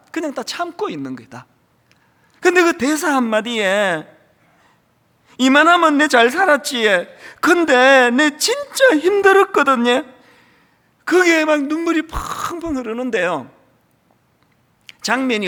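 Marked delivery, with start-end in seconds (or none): 5.4-10.0 louder and higher, close to tears; emotional Gyeongsang quote. Acted. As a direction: pour out the old man's grief in Gyeongsang dialect. Repeating the line that broke him. (경상도 사투리로 북받치게)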